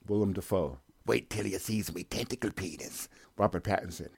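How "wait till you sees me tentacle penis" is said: put-on softer leering old salt; old sea captain. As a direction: purr it like a creepy old sailor.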